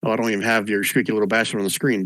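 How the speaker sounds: normal talking voice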